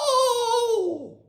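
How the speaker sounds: long, very high amazed whoa; stretched-out wonderstruck creature cry; monster's whoa. Pretend. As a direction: let out a cartoon monster's thrilled whoa. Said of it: "It made it go".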